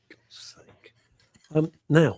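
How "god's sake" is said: whispered exasperation; exasperated whisper to self; whispered aside. Real, not acted. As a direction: breathe it under your breath. Muttered aside after protest.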